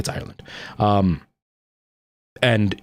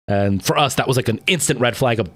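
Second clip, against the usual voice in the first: normal talking voice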